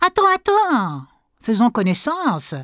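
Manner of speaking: high, insistent wolf voice; sly wolf. Acted. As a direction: keep the prey talking. Wolf holds the fish. (loup insistant et rusé)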